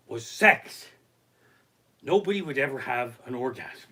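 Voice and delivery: ordinary talk, nothing marked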